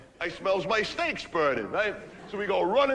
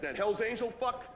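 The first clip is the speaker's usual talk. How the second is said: usual speaking voice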